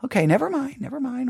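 high, softening mock retreat; backing down. Preacher retreating after being called dramatic, meekly.

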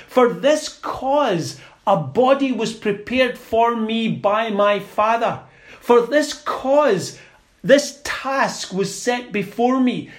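a hint of a royal proclamation, slowed on For this cause; emphatic declaration. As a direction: declare it like a king claiming his throne. Preacher voicing Jesus' declaration.

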